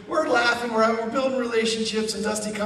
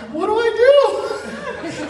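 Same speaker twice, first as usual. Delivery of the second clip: very high, slow and louder, through laughter; mock nervous squeal; 5 dB louder, much slower than usual. Teller is stuck for an excuse, hammed-up nervous squeal. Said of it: "'cause I'm really nervous".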